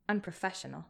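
ordinary delivery, nothing marked

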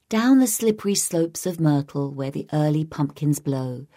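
plain reading voice

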